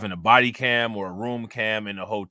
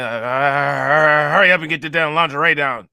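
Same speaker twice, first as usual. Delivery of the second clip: loud, higher-pitched mock hurrying; impatient rushing; 4 semitones higher, 8 dB louder than usual. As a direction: push it out loudly, acting out impatience. Acts out Harvey Weinstein rushing her.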